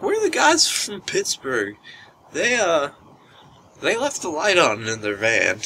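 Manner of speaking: high, slurry hammed-up drunk voice; a drunk woman asking the room for the guys from Pittsburgh. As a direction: imitate a hammered lady looking for someone. Drunk Sonia stumbling in.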